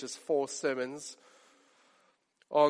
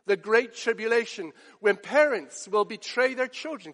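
raised, urgent sermon voice; fervent preaching; 6 semitones higher, 4 dB louder than usual. Acted. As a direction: press the urgency on the congregation. Preaching the end times.

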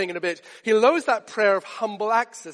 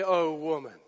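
lower, slow gentle wonder; tender praise; 6 semitones lower, 4 dB quieter, slower than usual. Retold or imitated. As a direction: bless her softly. Jesus answering the woman.

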